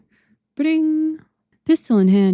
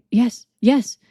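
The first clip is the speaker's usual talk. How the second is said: eager whisper; 3 semitones higher, 4 dB louder than usual. A narrator's reading. Eager old man whispers.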